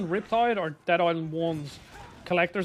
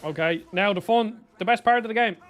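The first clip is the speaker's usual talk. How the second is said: normal talking voice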